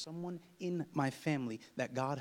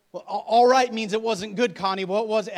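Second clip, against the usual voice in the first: loud, raised, stammering worry; mock panic; 9 semitones higher, 18 dB louder than usual. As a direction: fret aloud, tripping on the first word. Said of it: "And she starts panicking".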